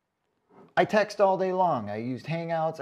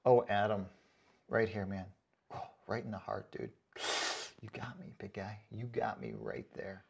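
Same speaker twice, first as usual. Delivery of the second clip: much softer, lower fake heartbreak; mock wounded; 7 semitones lower, 9 dB quieter than usual. Telling commenter Adam he got him, mock wounded and quiet. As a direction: pretend the insult stabbed you. Pretending to be hurt.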